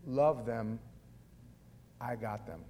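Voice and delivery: low, quiet reassurance; imitating the Lord. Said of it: "The Lord's like".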